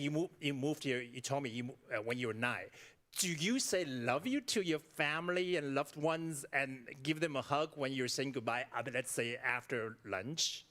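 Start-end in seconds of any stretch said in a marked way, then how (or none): none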